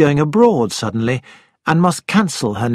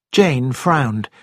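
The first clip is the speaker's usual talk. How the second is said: plain reading voice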